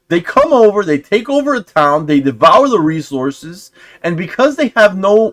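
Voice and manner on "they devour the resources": ordinary delivery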